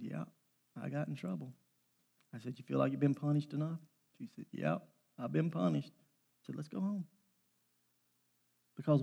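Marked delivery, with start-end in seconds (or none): 0.0-7.1 soft exchange. Retold or imitated. Quiet exchange with punished daughter.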